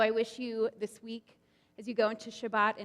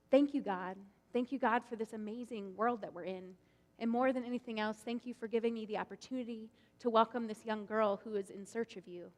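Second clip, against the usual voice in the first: gentle prayer; 5 dB quieter than usual. Acted. Abraham praying in story.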